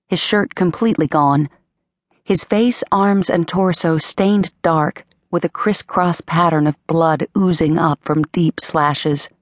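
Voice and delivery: ordinary delivery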